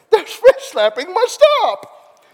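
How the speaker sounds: loud, high, drawn-out cartoon cry; hammed-up decree, stretched out slowly; cartoon quote. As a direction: belt it high and slow like a cartoon character. Imitating a cartoon line, Veggie Tales.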